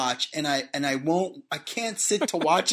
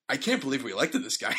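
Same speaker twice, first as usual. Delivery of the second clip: fast, lower mock dismay; dismayed disbelief; 4 semitones lower, faster than usual. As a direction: rattle it off in comic despair. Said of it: "entirely different range of emotions".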